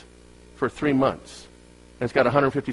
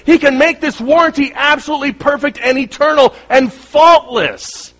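high, loud preaching climax; fervent preaching; 11 semitones higher, 9 dB louder than usual. Acted. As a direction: preach it, building with conviction. Preaching at full force.

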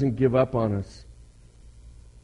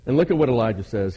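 ordinary delivery

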